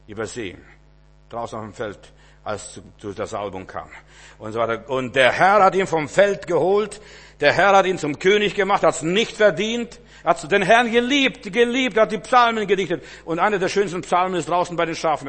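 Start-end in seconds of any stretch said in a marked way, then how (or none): 4.9-15.3 higher, louder, full-force sermon voice; fervent preaching. Acted. Preacher telling David's story, loud fervent preaching. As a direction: preach it out with fire.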